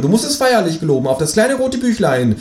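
high, solemn creature voice; Känguru. A narrator's reading. Kangaroo demands companion's silence, high insistent voice. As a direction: lay down the terms in a high voice. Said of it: "sagt das Känguru".

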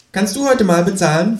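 high cheeky kangaroo voice; Känguru. Acted. (Känguru mit hoher, frecher Stimme)

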